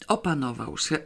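ordinary delivery, nothing marked

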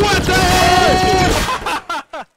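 high, loud and stretched out slowly; shocked outcry. Real, not acted. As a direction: drag it out in disbelief. Character dies in game.

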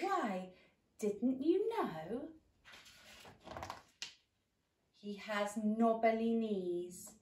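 slow, loud spooky mouse. Acted. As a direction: drag it out to frighten. Mouse describes gruffalo.

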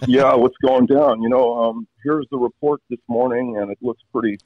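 slow, high laid-back sunbaked dude; surfer dude voice. Shown as a demonstration. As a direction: drawl it out like a lazy California dude. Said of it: "this sun-baked kind of guy".

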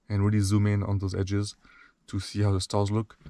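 soft, drowsy early-morning voice; sleepy voice. Real, not acted. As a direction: talk like you just woke up. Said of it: "sleepy voice in the morning".

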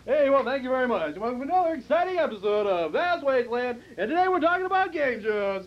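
booming TV host announcer. Acted. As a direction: pump it up like a game show host. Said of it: "I'm not gonna do this announcer voice".

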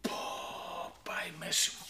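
whispered groan over a hurt back; pained whisper. Real, not acted. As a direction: breathe it out in pain, voiceless. Hurt his back while chasing.

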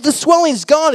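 high, loud astonished cry; amazed. Acted. Man sees his friend's swollen leg healed, loudly amazed.

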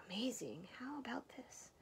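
soft, whispery testing voice; muffled under pillow. A narrator's reading. Mouse tests Bear's hearing under the pillow, hushed. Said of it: "Mouse said from under his pillow".

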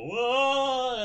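loud rising ghostly moan; spooky whoa from a haunting spirit. Retold or imitated. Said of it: "manifest and just go".

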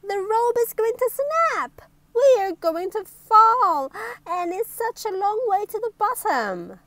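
high, loud storybook panic; panicked cry. Acted. Mister Brave stuck high on the tightrope, panicked cry. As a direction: cry out for help, pitch way up. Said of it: "he cried out in panic".

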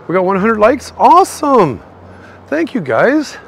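thrilled thanks to the chat; excited. Real, not acted. Streamer celebrating a hundred likes, high loud cheer.

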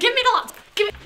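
loud high desperate demand; shouting struggle. Acted. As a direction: scream while grabbing. Struggle over laptop.

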